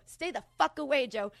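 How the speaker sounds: loud, high, forceful order; pretend furious warning. Sister wielding umbrella against Joe, shouting. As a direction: drive him back with your voice. Acted fantasy fending off Joe.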